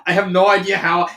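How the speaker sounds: ordinary delivery, nothing marked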